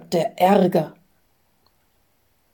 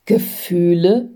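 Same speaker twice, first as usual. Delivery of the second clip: mildly puzzled question, rising at the end; astonished. A narrator's reading. Man questioning his guide about feelings, mildly astonished. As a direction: echo it as a surprised question. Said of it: "fragt der Mensch erstaunt".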